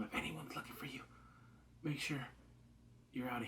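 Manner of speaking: put-on a girl warning her injured companion; quiet warning. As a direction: hush it like a secret. Ellie warns Joel quietly.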